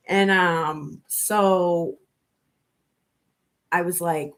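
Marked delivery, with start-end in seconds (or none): none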